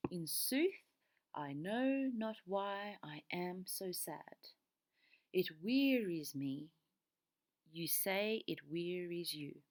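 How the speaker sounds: slow, measured beat stretching "know", "wearies" and "say"; iambic verse reading. Shown as a demonstration. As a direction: recite slowly, leaning on "know", "wearies" and "say". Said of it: "let's look at this example".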